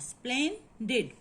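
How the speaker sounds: "Splendid" as two separate beats, splen, did; split into syllables. Shown as a demonstration. Teacher counting two sounds for students, "Splendid" split in two. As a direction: leave a gap inside "Splendid" before did. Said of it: "Here is in a two sound".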